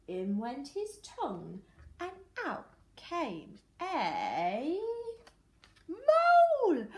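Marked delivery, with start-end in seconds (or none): 2.0-6.9 slow drawn-out build then a loud high burst on mole; suspense reveal. A narrator's reading. Teacher reading to children, building suspense before the big reveal. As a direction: hold the beat, then pop the answer out loud.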